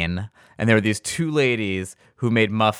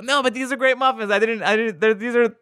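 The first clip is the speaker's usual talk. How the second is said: much higher, stammering and apologetic; sheepish consoling chef; 10 semitones higher than usual. Retold or imitated. Chef consoling the beaten ladies, high and flustered. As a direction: backpedal guiltily after winning. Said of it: "He was like".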